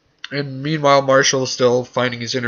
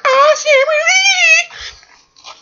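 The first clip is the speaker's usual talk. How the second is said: high, slow swooning cry; mock swooning cry; 6 dB louder, much slower than usual. Mocking Marshall's spirit goddess, a high slow swooning cry. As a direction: wail it like a dramatic diva. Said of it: "He's like".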